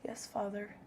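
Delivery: hushed quiet yes; soft and quiet. Shown as a demonstration. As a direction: say it softly, almost under the breath. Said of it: "in a more breathy voice".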